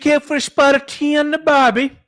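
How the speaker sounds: very high, loud hammed-up British toff; over-the-top posh British accent, squeaky and loud; mock British accent. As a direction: play a cartoon English gent. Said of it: "you have to do a fake Texan accent now".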